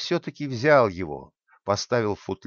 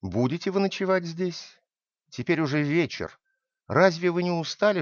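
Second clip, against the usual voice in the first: put-on an old woman's wary question, lightly higher; uneasy hostess; 7 semitones higher than usual. A narrator's reading. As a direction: ask the stranger nervously, a bit higher. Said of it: "и она спросила".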